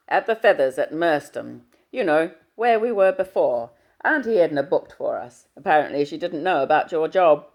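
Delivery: booming fatherly hello down the line. Acted.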